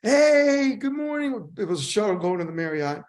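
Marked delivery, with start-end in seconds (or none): 0.0-1.5 bright, much higher and louder hello; cheerful greeting. Retold or imitated. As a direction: greet everyone like it's the best day ever. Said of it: "he's very happy all the time".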